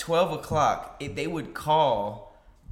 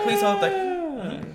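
mock phone assistant; slower than usual. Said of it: "That's what I want, not just like".

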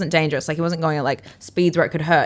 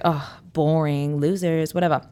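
slow, softer sulky put-down; mock dismissive; 4 semitones lower, 4 dB quieter than usual. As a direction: brush them off as losers. Dismissing the men riding off.

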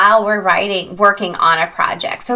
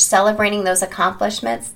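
ordinary delivery

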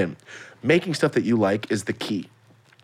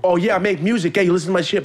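pushy rapper; 8 semitones higher, 4 dB louder, faster than usual. Retold or imitated. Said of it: "When they walk in the room".

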